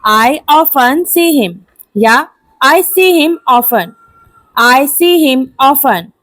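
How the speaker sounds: ordinary talk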